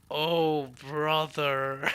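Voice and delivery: loud, drawn-out "oh, brother"; mock dismay. Friends reacting to the anime's plot, mock exasperation. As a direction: imitate a group's exasperated groan. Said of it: "we were all there like".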